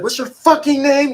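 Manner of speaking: high, loud mock officer's yell; fake yell. Officer demanding an inmate's name, mock yelling. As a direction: bellow it high like an overbearing officer. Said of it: "He trying to fake yell at me and shit".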